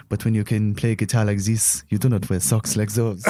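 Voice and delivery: lightly voiced French villain accent, unhurried; a mild sneering French bad guy, not overdone; a hint of a French accent. French stranger judges the singer's socks, light French accent. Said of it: "that's what he sounded like".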